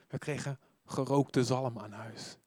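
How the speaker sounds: ordinary talk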